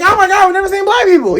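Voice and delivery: fast, very high gushing awe; mock amazement. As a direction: squeal it quickly, nearly laughing. Voices amazed people in Asia.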